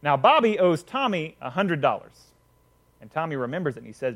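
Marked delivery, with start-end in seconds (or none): none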